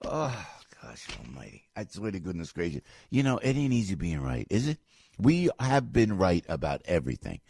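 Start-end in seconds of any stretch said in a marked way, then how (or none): 0.0-2.8 weary exasperation. Real, not acted. Exasperated sigh after rant.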